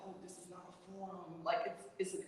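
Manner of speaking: soft, close, under the breath; hushed warning. Retold or imitated. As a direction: warn a friend quietly.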